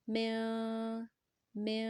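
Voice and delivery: "میں" held two seconds with a nasal hum; held nasal ikhfa. Shown as a demonstration. Teacher shows ikhfa before jeem, held nasal sound. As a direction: stretch the n of "میں" through the nose. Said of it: "دو second تک لمبا کریں گے".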